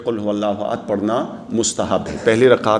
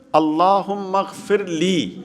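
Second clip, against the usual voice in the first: slow, raised-pitch demonstration of a prayer; slow recitation; 6 semitones higher, much slower than usual. Shown as a demonstration. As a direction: draw the supplication out, higher in pitch. Teacher recites short supplication. (کھینچ کر آہستہ دعا پڑھنا)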